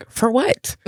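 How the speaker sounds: high "for what?" in disbelief; incredulous. Retold or imitated. Mom answering daughter's mall request, incredulous.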